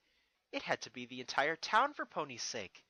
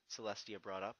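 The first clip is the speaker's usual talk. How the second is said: ordinary delivery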